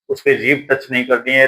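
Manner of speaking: ordinary delivery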